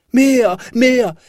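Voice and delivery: put-on loud, high cry of a greedy child; high-pitched, insistent yell; demanding shout. A narrator's reading. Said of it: "schrie Häwelmann".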